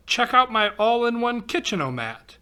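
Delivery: ordinary delivery, nothing marked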